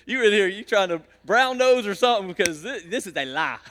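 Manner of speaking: teasing, laughing accusation; playful disbelief. Retold or imitated. Pastor teasing a church member, playful disbelief. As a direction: call her bluff with a grin. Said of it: "I was like".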